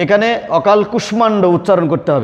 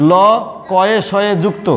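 ordinary delivery

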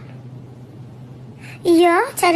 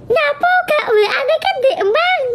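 laughing outburst; 9 semitones higher than usual. Real, not acted. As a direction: burst out laughing as you ask. Laughing outburst about the WhatsApp.

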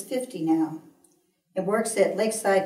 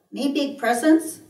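higher, louder eager question from a daughter; calling out; 7 semitones higher, 5 dB louder than usual. A narrator's reading. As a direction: call it out as your daughter would, pitched up. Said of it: "calls out".

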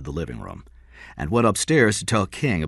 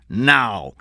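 raised-voice order; a mildly shouted command; 4 semitones higher, 4 dB louder than usual. A narrator's reading. Policeman orders the crook to leave, shouting. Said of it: "Meyer shouted".

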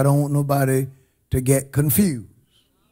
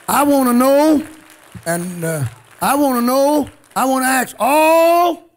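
higher and louder, calling out; I wanna know, cried out high; rising preacher cry; 7 semitones higher, 3 dB louder than usual. Real, not acted. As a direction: lift it up and demand an answer. Preacher rising to full force.

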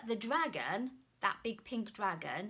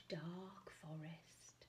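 slow, hushed whisper; spooky whisper; 4 semitones lower, 13 dB quieter, slower than usual. A narrator's reading. Storyteller whispering to children about the forest. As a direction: breathe the words like a dark secret.

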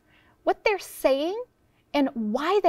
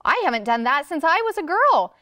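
higher, excited storybook neighbor; 5 semitones higher, 4 dB louder than usual. A narrator's reading. Neighbor hears of the bee tree hunt, speaking excitedly. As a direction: let excitement lift the pitch. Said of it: "Missus Govlock said excitedly".

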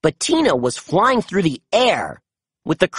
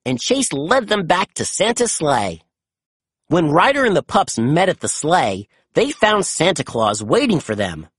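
ordinary delivery

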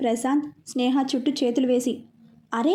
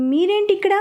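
flustered; 6 semitones higher, 3 dB louder than usual. A narrator's reading. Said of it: "అంది కంగారుగా".

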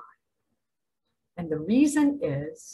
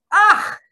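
loud, high ugh of disgust; disgusted groan; 13 semitones higher, 11 dB louder than usual. Real, not acted. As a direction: spit out a revolted ugh. Disgust at Trump.

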